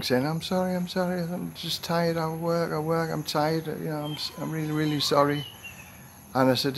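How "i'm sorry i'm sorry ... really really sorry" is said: higher, softer mock tearful pleading; imitating crying. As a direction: lightly imitate a girl apologising through tears. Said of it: "she started crying".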